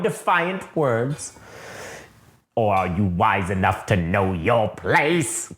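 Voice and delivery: slow, lightly voiced sinister Emperor; a hint of a mocking old Emperor. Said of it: "he says".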